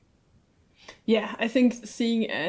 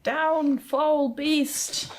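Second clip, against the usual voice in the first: high heroic command; battle shout; 6 semitones higher, much slower than usual. Acted. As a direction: cry it out high, commanding a monster down. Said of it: "while shouting that".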